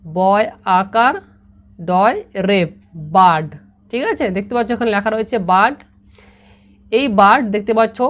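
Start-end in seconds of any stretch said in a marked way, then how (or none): 0.0-2.7 বয় আকার ডয় রেপ said very slowly, sound by sound; deliberate letter-by-letter spelling. Shown as a demonstration. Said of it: "কীরকম হবে?". 3.1-3.5 bird said as a clear, firm model word, lower and falling; bird pronounced as "bard"; English word said clearly. Shown as a demonstration. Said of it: "কীরকম হবে?".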